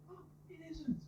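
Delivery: high, soft mock gasp of disbelief; mock starstruck onlooker. Someone spotting [name] in an advert, mock disbelief. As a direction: squeak it softly, imitating an advert character. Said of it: "someone sees [name] and says".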